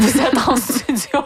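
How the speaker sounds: amused, laugh-filled delivery. Real, not acted. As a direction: tease a colleague with a laugh breaking in. Teasing guest, laughing as she speaks.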